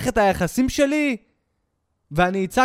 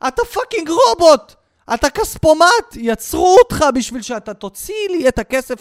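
higher, louder mock rage; yelling at the ATM; 7 semitones higher, 6 dB louder than usual. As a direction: berate the machine. (צועק על הכספומט בזעם מעושה, בקול גבוה וחזק)

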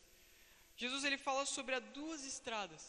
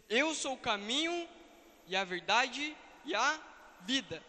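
preaching at full force; 6 dB louder than usual. Acted. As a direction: proclaim it like a preacher. Pregador proclama a citação de Jesus. (proclamação enfática de pregador)

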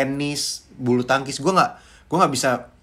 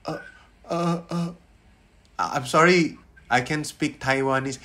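flustered stammer; 4 dB quieter, slower than usual. Retold or imitated. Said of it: "gue kagok dong".

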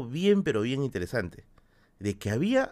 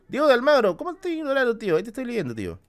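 indignant protest; 9 semitones higher, faster than usual. Real, not acted. Streamer protesting to a viewer in chat, high indignant outburst. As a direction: protest to chat, I am reading you.

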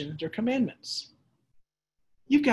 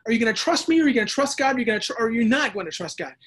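exasperated God; 5 semitones higher, 5 dB louder, faster than usual. Retold or imitated. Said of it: "And it's like".

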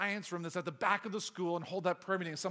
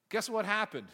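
ordinary talk

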